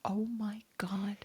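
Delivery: breathless, soft, slow Oh my God; hushed gasp of shock; shocked whisper. Real, not acted. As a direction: whisper it in disbelief. Shocked at the crime story.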